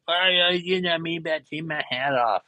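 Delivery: high-pitched put-on griping voice; mock indignation, pitched well up; mock angry complaint. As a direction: imitate someone whining crossly about a rule. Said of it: "get all mad at me".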